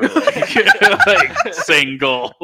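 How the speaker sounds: high, laughing confession; real giggles breaking up the words; laughing through words. Real, not acted. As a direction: crack up while admitting it. Laughing at own single days.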